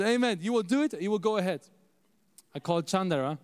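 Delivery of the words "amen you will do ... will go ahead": higher imitated Ghanaian English; Ghanaian accent. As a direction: give a Ghanaian mentor's emphatic blessing. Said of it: "so he speaks a little different".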